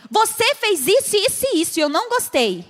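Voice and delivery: blaming demo of bad feedback; accusing. Shown as a demonstration. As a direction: list the faults like an accusation. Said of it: "a gente só fala assim".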